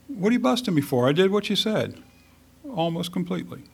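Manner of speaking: high-pitched, whiny self-defense. Acted. Saul excusing himself after being challenged, whiny. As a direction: complain up high, softening at the tail. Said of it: "he comes off as kind of whiny".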